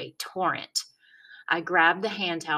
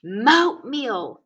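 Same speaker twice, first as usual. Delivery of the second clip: put-on louder, higher insistent kid; whiny kid yell; 5 semitones higher, 7 dB louder than usual. A narrator's reading. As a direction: stamp the word moat like a stubborn five-year-old.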